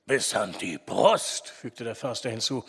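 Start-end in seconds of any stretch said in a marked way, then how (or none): 0.1-1.5 low, slow forester voice; deep, deliberate character voice. A narrator's reading. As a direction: draw it out low, as the old forester. Forester's gruff voice in narration.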